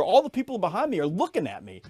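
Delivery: ordinary delivery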